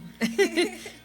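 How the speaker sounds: short burst of real laughter; embarrassed laugh. Real, not acted. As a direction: laugh it off after choking. Laughs at own choking.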